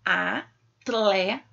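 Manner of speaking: "Atleta" slowly split into a, tle, ta; sílaba por sílaba. Shown as a demonstration. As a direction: say "Atleta" one syllable at a time. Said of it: "basta separar em sílabas". ("Atleta" devagar, separada em a, tle, ta)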